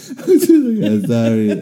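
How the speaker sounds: apology broken up by laughter; laughing. Retold or imitated. Storyteller retells apologizing to little girl, laughing. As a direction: crack up while quoting the apology.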